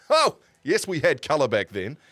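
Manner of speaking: a sudden high, loud, hammed-up cry of delight; mock excitement. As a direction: play it up as if colour photos were a marvel. Joking about colour photos.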